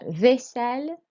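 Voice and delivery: "vaisselle" with hissed double S and clear L; French "vaisselle", L sounded; long S. Shown as a demonstration. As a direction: say "vaisselle" as vay-sell. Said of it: "a long S sound with a double S".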